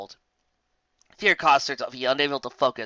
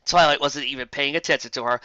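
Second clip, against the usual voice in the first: ordinary delivery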